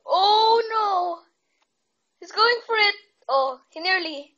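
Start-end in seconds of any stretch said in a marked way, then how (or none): 0.1-3.0 higher, louder panicked cry. Real, not acted. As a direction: yelp it as the hunter closes in. Hunter nearly finds his hiding spot.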